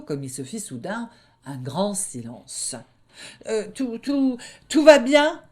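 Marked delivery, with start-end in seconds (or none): 3.5-5.4 high, stammering, anxious bluff; shaky-pitched loud stammering wolf; nervous stammer. Acted. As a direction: stutter while trying to sound fine. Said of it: "répondit le louvard".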